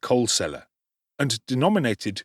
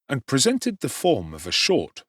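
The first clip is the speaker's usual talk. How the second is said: usual speaking voice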